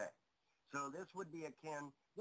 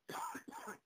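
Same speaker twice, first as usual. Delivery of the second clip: coughing; 3 dB louder than usual. Real, not acted. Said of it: "real cough mid-show".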